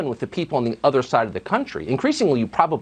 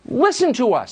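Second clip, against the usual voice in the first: scream; 9 semitones higher than usual. A narrator's reading. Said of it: "screams the population".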